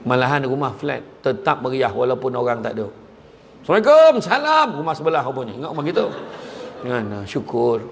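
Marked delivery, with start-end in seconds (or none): 3.6-4.7 high, loud call of salam; loud greeting. Acted. Neighbour greeting loudly, comedic. (salam dilaung kuat)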